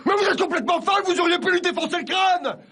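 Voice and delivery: furious high-pitched shouting; losing his temper. Acted. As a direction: explode in outraged disbelief. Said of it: "je m'énerve". (engueulade furieuse, voix qui monte)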